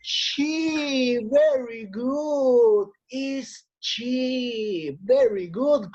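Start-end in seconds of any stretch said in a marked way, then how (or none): none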